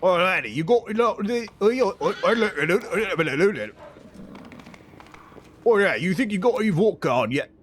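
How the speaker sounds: would-be Scottish turned Cockney; Cockney accent. Shown as a demonstration. Streamer losing the Scottish and landing in Cockney, Cockney accent. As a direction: stammer into a hammed-up Cockney accent. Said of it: "Cockney almost".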